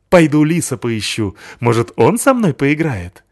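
loud, bouncy; cheerful dog. Acted. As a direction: off to find the fox. Пёс уходит к лису. (весело, по-щенячьи)

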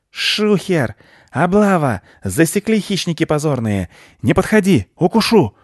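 high, loud squirrel cries; frantic, piercing bursts; panicked alarm. Acted. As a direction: yelp warnings as a cornered thief. Cornered squirrel raises the alarm.

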